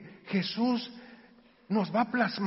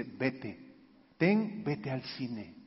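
gentle father; 8 semitones lower, 7 dB quieter, much slower than usual. Retold or imitated. Father hands money, sends son off.

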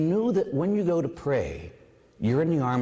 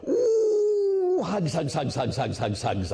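cooing hugs; 6 semitones higher, slower than usual. Retold or imitated. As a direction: coo to a toddler as you hug her. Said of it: "I'd say".

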